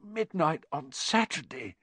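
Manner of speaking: slow, high hoarse echo of dawning alarm. A narrator's reading. Ron sits bolt upright and breaks into a sweat, hoarse. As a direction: repeat it slowly in a hoarse, strained voice. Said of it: "he said in a hoarse voice".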